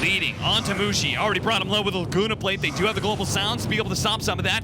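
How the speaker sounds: fast, rising esports casting call; hyped play-by-play. Acted. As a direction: build the hype as the fight breaks. Live play-by-play excitement.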